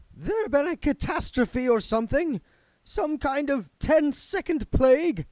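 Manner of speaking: a nervous ghost demanding answers; petulant spirit guide. Acted.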